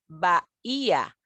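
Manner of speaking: BAHÍA drawn out slowly as ba-í-a; "bahía" in slow, separate syllables, weight on the Í; vowels separated. Shown as a demonstration. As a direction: say "bahía" slowly, splitting A from a stressed Í. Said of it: "el hiato hace que se me separen".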